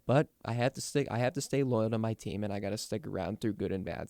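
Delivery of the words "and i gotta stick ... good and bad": ordinary delivery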